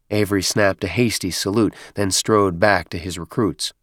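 plain reading voice

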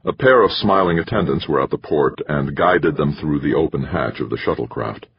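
ordinary delivery, nothing marked

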